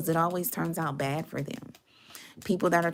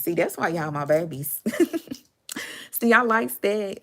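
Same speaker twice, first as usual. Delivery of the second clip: ordinary delivery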